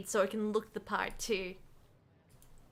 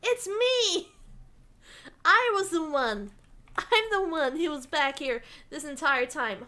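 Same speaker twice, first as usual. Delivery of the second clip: mock dramatic reveal; 4 semitones higher than usual. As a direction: burst out as the playful surprise culprit. Mock reveal as the background figure.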